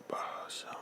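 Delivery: imitating a barely audible voice; faint whisper. Imitating his father faintly repeating the name, in a whisper. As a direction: breathe the word like a dying man. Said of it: "I mean, it's that faint".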